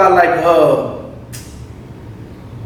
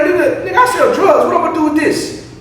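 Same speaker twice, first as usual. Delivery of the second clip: high-pitched, incredulous mock protest; imitated indignant dealer; 9 semitones higher than usual. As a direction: mimic an offended seller, voice pitched up. Retold dealer refusing gift card.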